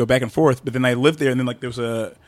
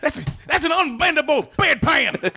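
wrestling commentator; 12 semitones higher than usual. Pretend. Commentator bit.

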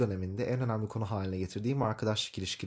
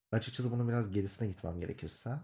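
usual speaking voice

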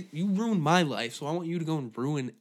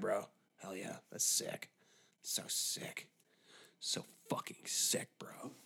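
breathless whisper, softer; whispered hype; 6 semitones lower, 14 dB quieter than usual. Acted. Bro whispering about a movie role, hyped but hushed. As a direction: hush it like a secret thrill.

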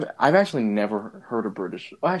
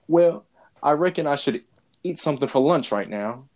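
lightly put-on British accent, louder; 4 semitones higher, 3 dB louder than usual. His inner voice after a long British stream, mild British accent. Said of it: "with a British accent".

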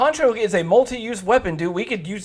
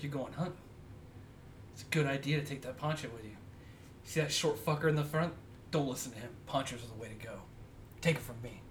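hushed cop voice; 7 semitones lower, 18 dB quieter than usual. Retold or imitated. Retelling cop's remarks, hushed.

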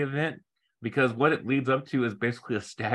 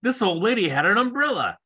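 pompous detective; 6 semitones higher than usual. Pretend. Mocking the cops' accusation.

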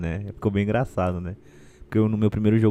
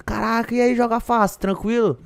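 excited fan voice; 11 semitones higher than usual. Retold or imitated. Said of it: "os caras do posto vinham".

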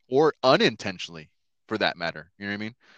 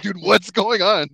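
mock disbelief; 10 semitones higher, 8 dB louder, slower than usual. Retold reaction at Bible study.

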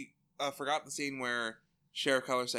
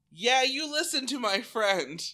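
mock taunt; 10 semitones higher, 7 dB louder than usual. Taunting backup line.

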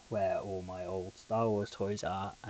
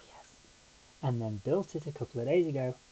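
ordinary talk

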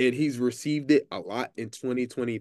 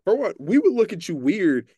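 higher, louder, disbelieving; incredulous; 6 semitones higher, 6 dB louder than usual. Real, not acted. Texan host baffled by county naming, incredulous. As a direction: ask it like it makes no sense.

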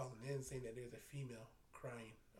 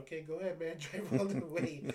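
laughing tease; 4 semitones higher, 10 dB louder than usual. Retold or imitated. Teasing his partner to drive on, laughing loudly. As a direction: egg him on while laughing.

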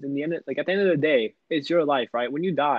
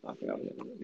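whisper; 15 dB quieter than usual. Real, not acted. Muttering to himself, trying to remember.

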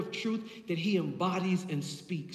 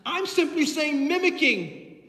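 loud, building pulpit declarations; preaching; 7 semitones higher, 8 dB louder than usual. Real, not acted. Preacher tells congregation which truth frees, forceful preaching. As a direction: drive each phrase home to the congregation.

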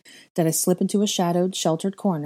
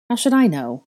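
snapped back, louder and higher; sharp retort; 4 semitones higher, 6 dB louder than usual. A narrator's reading. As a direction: throw the question back at her. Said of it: "he retorted".

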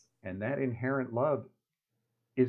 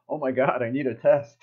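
panicky townsperson; 2 semitones higher, 8 dB louder than usual. Retold or imitated. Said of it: "everybody's gonna think".

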